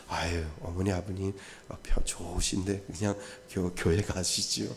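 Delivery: put-on soft, hushed, overly polite grown child; a grown child gently fobbing off the parents; polite brush-off. Grown child politely turning down church, softly.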